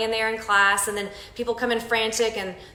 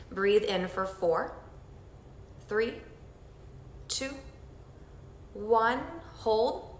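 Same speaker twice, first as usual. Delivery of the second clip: soft, slow counting through each breath; guided breathing count; 9 dB quieter, slower than usual. Acted. Instructor leading the group through breathing, soft slow count. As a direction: guide them through the breath, gently and slowly.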